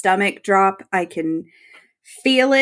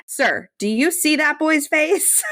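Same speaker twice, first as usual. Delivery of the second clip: slow, much higher incredulous scolding; mock exasperation; 8 semitones higher than usual. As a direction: drag it out as if you can't believe him. Scolding Charlie in disbelief.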